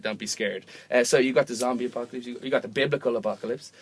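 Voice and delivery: usual speaking voice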